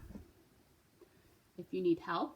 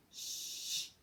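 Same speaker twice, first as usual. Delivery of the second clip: a short whispered shh for the rest; rest hush; 7 dB quieter than usual. Shown as a demonstration. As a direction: hush for one beat. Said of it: "You can say either rest or shh".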